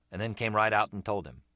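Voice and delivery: usual speaking voice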